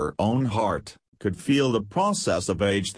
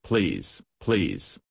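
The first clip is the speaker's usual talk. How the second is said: mocking; 4 semitones lower than usual. A narrator's reading. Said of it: "she mocked".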